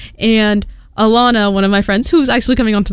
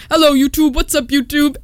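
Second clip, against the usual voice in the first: higher-pitched imitation of a thick British accent; mock British accent; 6 semitones higher than usual. Drunk guys hailing YouTube, mock British accent. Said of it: "very thick British accent".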